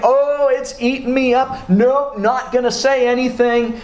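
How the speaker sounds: a man gritting it out, burning up inside; keeping his mouth shut while it eats him up; agitated inner struggle. Retold or imitated. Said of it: "notice what David's saying here".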